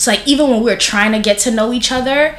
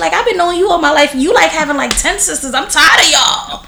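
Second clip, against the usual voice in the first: raised, exasperated voice quoting herself; fed-up retort pitched up high; mock retort; 8 semitones higher than usual. To her sister about their half-siblings, a high exasperated retort. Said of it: "I was just like".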